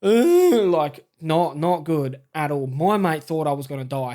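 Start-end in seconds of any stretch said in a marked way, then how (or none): 0.0-0.7 loud, high-pitched wail; imitated scream. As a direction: re-enact a sudden screaming outburst. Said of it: "screaming and being like".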